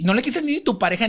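ordinary talk

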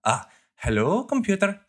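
hammed-up Scotty, hello computer rising high at the end; quoting Scotty into the mouse. Recapping the movie to cohosts, mock Scotty voice with a rising computer. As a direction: speak into the mouse like a microphone, pitch soaring on computer. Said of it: "puts it up to his face and he's like".

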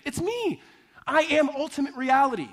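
high, emphatic pulpit proclamation; intense, ringing sermon climax; preached declaration. Acted. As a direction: declare it as Jesus, voice lifted. Preacher voicing Jesus' climactic claim.

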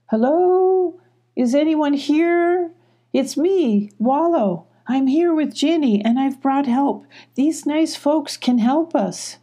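high, sustained storybook call. A narrator's reading. Wallow climbs, calling to friends and neighbors, high. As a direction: call up into the trees, pitched high. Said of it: "calling to his friends and neighbors".

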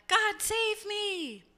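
high, drawn-out cry to heaven; mock plea, stretched long and high. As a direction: call out to God for rescue, hammed-up. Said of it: "the man cries out to God".

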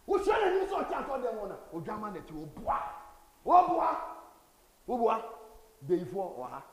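high, very loud preacher's shout; preaching at full force. Acted. Preacher rousing the church, very loud and high. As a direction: hammer each line at the congregation.

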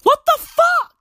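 shrieked disbelief. Retold or imitated. Comic retelling his reaction to the sticker, a shrieked outrage. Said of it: "I was like".

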